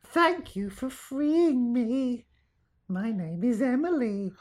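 put-on high, slow, eerie little girl; sing-song creepy ghost child. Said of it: "Do it in a creepy girl voice".

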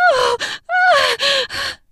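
high loud shriek. Acted. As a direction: shriek out in pleasure. Woman shrieks out.

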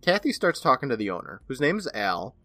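normal talking voice

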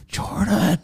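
soft, high urgent calling of a name; acted-out stage-whisper call; hushed calling. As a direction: call to wake someone without being heard. Waking partner quietly in retold story.

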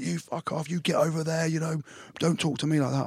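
high, hushed angry snap; retold coach's retort. Coach telling the player to back off, high hushed anger. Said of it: "He's like".

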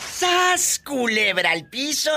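high, hammed-up shock; theatrical gossip-host exclamation; catchphrase cry. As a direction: squeal it high like juicy scandal.

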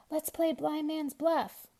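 high, slow eager call; excited cry. A narrator's reading. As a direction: propose the game with glee. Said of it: "cried Anton".

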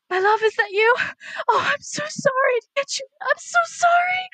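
high, crying apology to a loved one; tearful apology. Acted. Entranced medium tearfully apologizes to her lost love. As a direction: sob out the apology, voice pitched high. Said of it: "and she goes".

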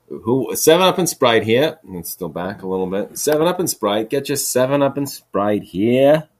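a stadium drinks seller crying his wares; vendor calling. Pretend. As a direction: sell soda to a stadium crowd.

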